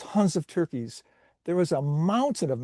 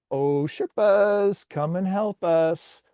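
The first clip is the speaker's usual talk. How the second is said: slow, drawn-out higher call; 4 semitones higher, slower than usual. Acted. Kids calling Sherpas to the present pile, drawn-out call. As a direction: call up the mountain for help.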